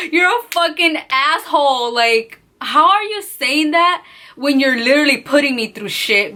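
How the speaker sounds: high-pitched, indignant disbelief; outraged. Real, not acted. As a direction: erupt in outrage at what was said. Outraged at his remark.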